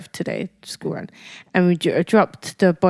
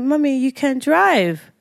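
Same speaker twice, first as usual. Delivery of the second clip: higher, drawn-out imitation of a child; lightly voiced young son; 6 semitones higher, slower than usual. Mother mimics son urging her to drive, lightly voiced child. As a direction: stretch it like a little boy pleading his case. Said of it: "And he's like".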